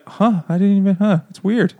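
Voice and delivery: high-pitched, clueless musing; mock oblivious surprise. Imitating her oblivious reaction.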